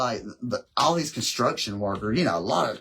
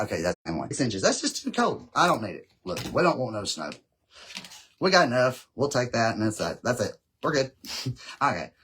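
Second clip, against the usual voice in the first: normal talking voice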